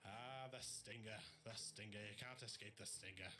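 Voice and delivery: a cheesy stage Irish brogue introducing the players; comedy Irish accent, Tom Cruise style; bad Irish accent. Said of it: "The worst Irish accent I've ever heard".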